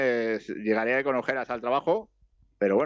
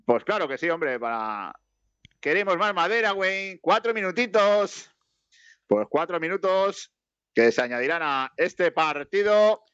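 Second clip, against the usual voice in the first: higher, slower stretched-out commentator call; milk the stoppage time like a TV commentator; play-by-play commentary; 7 semitones higher than usual. Acted. Mock live match commentary.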